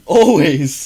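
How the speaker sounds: loud, high mock-outburst; emphatic exclamation. Retelling an answer about cam girls, loud exclamation. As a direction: belt it out as a retold answer. Acting out own answer to friend.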